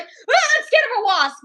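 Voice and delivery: mock frightened yelp, high and loud; panicked boy. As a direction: imitate a boy panicking at a wasp. Mimics boy scared of wasp.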